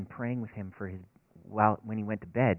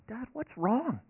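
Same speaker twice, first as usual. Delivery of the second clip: put-on higher, light little boy voice; child's voice; 12 semitones higher, much slower than usual. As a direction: voice the young son asking his dad. Seven-year-old son voiced.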